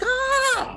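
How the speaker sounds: very high "ah" of mock rapture; elated fan cry. Fan hearing the main guy's voice at last, blissfully elated. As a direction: sigh out high, overjoyed relief. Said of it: "When I heard his voice at the end, I was like".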